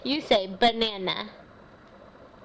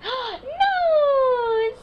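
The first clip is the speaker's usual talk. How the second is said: gasp, then a much higher, louder Nose; delighted exclamation; 14 semitones higher, 6 dB louder than usual. Acted. Mother celebrates her toddler finding her nose, excited. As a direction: cheer the word like a big discovery.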